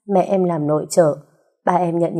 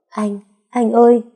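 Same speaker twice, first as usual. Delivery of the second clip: higher, louder tearful wail; sobbing cry; 5 semitones higher, 4 dB louder than usual. Acted. Wife sobbing for her husband lost in the fire, wailing. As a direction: cry out for him through sobs. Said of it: "ôm mặt khóc nức nở".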